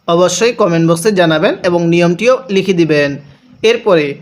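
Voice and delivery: normal talking voice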